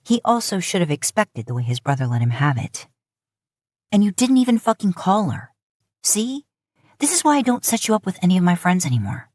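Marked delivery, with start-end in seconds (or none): 3.9-9.3 higher, fast scolding; a mild brotherly rant; bitching. Acted. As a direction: nag your brother, exasperated. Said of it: "Sam bitches at him".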